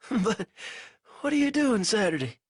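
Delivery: a weak captive fumbling for words; lightly voiced delirious prisoner; feverish prisoner. A narrator's reading. Banks voice.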